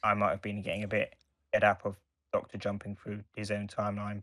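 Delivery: ordinary talk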